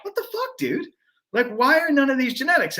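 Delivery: high, incredulous mock outburst; mock disbelief. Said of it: "And I'd be like".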